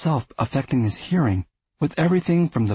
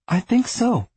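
high, earnest answer; 11 semitones higher than usual. Acted. Arthur answering honestly.

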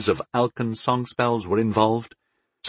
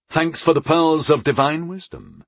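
mock gratitude, pitched up; sarcastic grunt; 6 semitones higher than usual. A narrator's reading. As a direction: thank her with dry sarcasm. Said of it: "I grunted sarcastically".

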